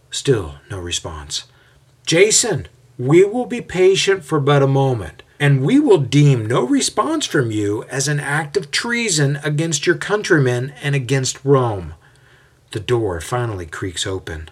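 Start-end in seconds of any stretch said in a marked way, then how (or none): 2.1-11.9 loud, high, formal warning; a leader's public ultimatum. A narrator's reading. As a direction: threaten him through the door. Ultimatum called at the door.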